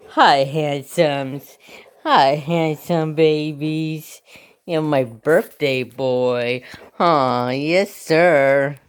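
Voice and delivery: slow adoring coo to a pet; gushing pet talk. Real, not acted. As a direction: dote on the dog, drawing words out. Cooing to her birthday dog.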